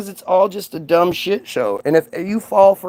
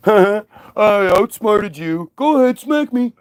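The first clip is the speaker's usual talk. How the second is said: smug mock gloat; 2 semitones higher, slower than usual. Mocking a smug voter's choice.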